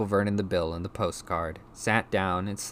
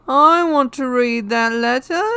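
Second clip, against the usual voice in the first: put-on slow, high, very loud boy; loud demand; 12 dB louder than usual. A narrator's reading. Ignored son demanding the letter, slow loud demand. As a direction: bellow each word in a high voice. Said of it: "he said loudly".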